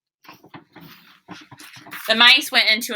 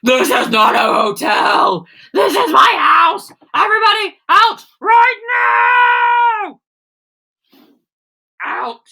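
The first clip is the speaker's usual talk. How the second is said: much louder, rising angry yell; furious shout; 13 semitones higher, 8 dB louder than usual. Acted. Bruce ordering the guests out of his house, furious shout. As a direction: throw everyone out at full voice. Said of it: "Bruce lost his temper".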